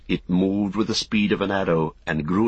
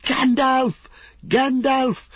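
high, exultant cheering shout; frenzied spectator's shout, pitch soaring; wild shouting; 13 semitones higher, 4 dB louder, slower than usual. A narrator's reading. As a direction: urge on a racer with wild high yells. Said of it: "he shouted wildly".

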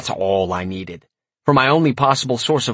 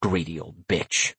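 muttered; 5 semitones lower, 7 dB quieter than usual. A narrator's reading. Narrator's muttered thought.

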